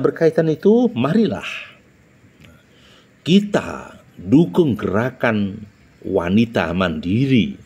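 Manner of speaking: tempo and pauses. Shown as a demonstration. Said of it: "jadi ada tempo, ada jeda".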